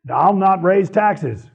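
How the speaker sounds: slow, emphatic pledge, lightly voiced; drawn out like a campaign promise; a hint of a politician's solemn vow; quoting a campaign pledge. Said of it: "ran on a policy".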